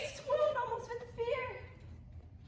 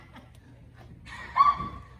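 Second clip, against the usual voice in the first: shrieking laugh; 7 dB louder than usual. Real, not acted.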